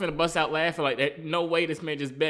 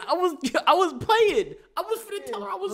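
laughing; 13 semitones higher than usual. Real, not acted. Laughing while backing off.

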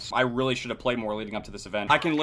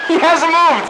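yelled disbelief; 12 dB louder, much slower than usual. Real, not acted. Repeats it yelled.

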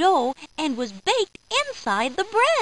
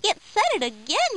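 higher-pitched, understated narrated yell; mild yell; 7 semitones higher than usual. A narrator's reading. Charlie reacts to the bread speaking, mild yell. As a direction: pipe up higher, a storybook mock yell. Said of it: "yells Charlie".

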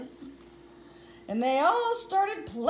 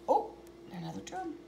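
usual speaking voice

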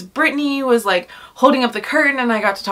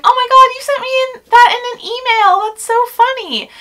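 very high, gushing awkward squeal; mock your own awkward gushing in a squeaky high voice; overdone high-pitched fangirl babble; 4 dB louder than usual. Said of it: "the awkward human that I am was like".

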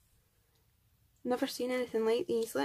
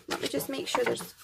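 ordinary talk, nothing marked

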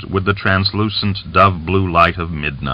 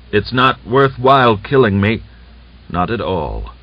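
plain reading voice

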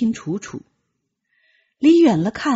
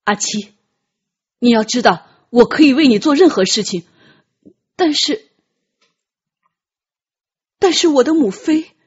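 louder, pressing pleading; pained young prince; 4 semitones higher, 6 dB louder than usual. Acted. Ji Zheng tells Tian Qi he's torn, pained. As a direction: hold back anguish, then break off.

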